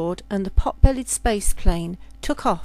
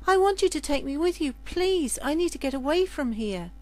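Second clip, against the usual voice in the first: put-on higher, quick, pleading girl's voice; pleading serving girl; 5 semitones higher than usual. Serving girl begging the ship's captain, higher and pleading. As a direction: beg to be taken away. Lydia begs to leave planet.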